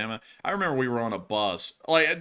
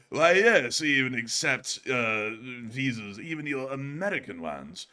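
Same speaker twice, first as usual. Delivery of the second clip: British man; slower than usual. Retold or imitated. British man at Blenheim Palace on Visa cards, mock British accent. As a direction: imitate a British man, drawn out. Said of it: "Matthew got the experience at".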